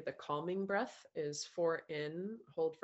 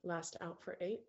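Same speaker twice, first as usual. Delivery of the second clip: calm meditation voice, softer than chat, with long pauses; guided meditation; 4 dB quieter than usual. Acted. Coach guiding a group through breaths and self-love, calm meditation voice.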